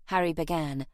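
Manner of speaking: usual speaking voice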